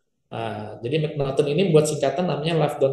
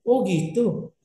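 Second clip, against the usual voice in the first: "Oh iya, oh gitu" said brightly and a bit higher; eager listener reply; 4 semitones higher, much slower than usual. Shown as a demonstration. Coach demoing an enthusiastic response to a speaker. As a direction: show keen interest with a lifted voice.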